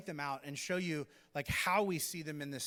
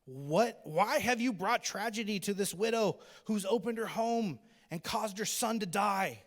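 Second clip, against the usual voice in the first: mock lament rising to a louder, higher plea; crying out to God; 4 semitones higher, 4 dB louder than usual. Elijah crying out to God over the dead boy, mock lament. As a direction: cry out to God, pleading. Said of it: "Elijah cried out to the Lord".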